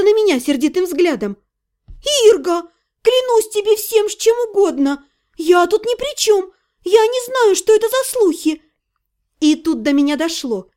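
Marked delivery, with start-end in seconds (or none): 2.3-8.6 high-pitched, anxious protest; pleading heroine. Acted. Heroine swearing to Irga she spread nothing, high pleading. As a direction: swear innocence in a raised, urgent voice.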